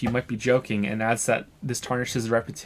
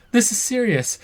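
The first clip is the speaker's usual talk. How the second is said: indignant protest; 12 semitones higher, 5 dB louder than usual. Acted. Cop pleading over laughter.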